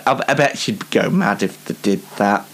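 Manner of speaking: ordinary talk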